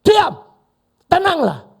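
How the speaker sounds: a high, loud command; commanding shout. Acted. Jesus rebuking the storm, loud commanding shout. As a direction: order the storm to be still. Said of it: "Cuma bilang apa?".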